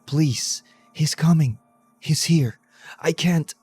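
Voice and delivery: frantic terror. A narrator's reading. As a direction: gasp it out in terror. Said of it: "whispered frantically".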